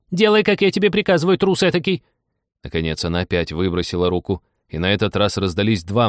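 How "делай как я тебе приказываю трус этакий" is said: bossy order. Acted. Master orders frightened servant.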